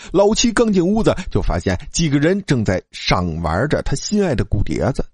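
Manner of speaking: ordinary delivery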